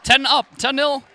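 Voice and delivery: high, loud goal call; excited shout. Real, not acted. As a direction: call the score out with a cheer. Live goal call by commentator.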